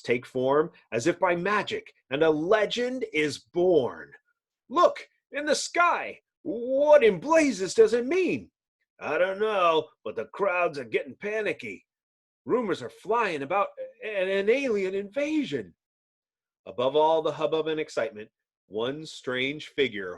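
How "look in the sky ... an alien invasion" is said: high, excited bystander voice; panicky onlookers. Acted. Citizens spotting the flare, high and alarmed. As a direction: gasp at the sky like a startled citizen.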